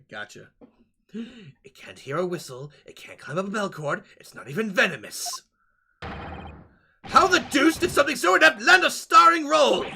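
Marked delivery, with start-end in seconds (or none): 6.0-9.8 higher, louder scornful outburst; indignant gentleman. Acted. Gentleman scorns sailor's snake, indignant. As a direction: scoff at the useless snake. Voicing game characters.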